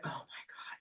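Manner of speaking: a breathy whispered gasp of realisation; whispered aside. Retold or imitated. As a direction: drop to a whisper as if caught out. Mom's shocked aside, voiced by teller.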